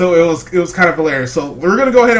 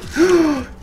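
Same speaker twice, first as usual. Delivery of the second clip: gasp; 11 semitones higher than usual. Real, not acted. Reacts to dropped water.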